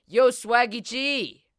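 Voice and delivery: drawn-out shout, high and loud, slow; calling out. Retold or imitated. Friends call nervous Swaggy G over with a long loud shout. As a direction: yell his name across the gym.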